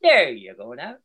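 soft Irish accent lifting up at the end; soft Irish lilt. Shown as a demonstration. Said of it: "have a soft accent and lilt it up at the end".

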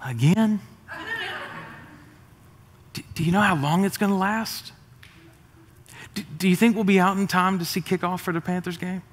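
mild weary reluctance, with hesitant pauses; mock a half-hearted churchgoer, stalling before each question; lightly acted lack of enthusiasm; reluctant churchgoer. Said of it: "he wasn't like".

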